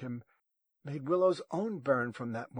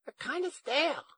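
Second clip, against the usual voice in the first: very high, louder granny voice; high-voiced confused grandmother; puzzled grandma; 6 dB louder than usual. A narrator's reading. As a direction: offer it in a high, befuddled granny voice.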